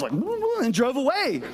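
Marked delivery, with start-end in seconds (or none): 0.1-0.6 mock alarm. Older driver backing off.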